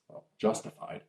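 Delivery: plain reading voice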